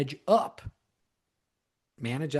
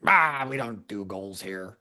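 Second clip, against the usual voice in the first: dismissive bad manager; 4 semitones lower, slower than usual. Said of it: "They would say something like".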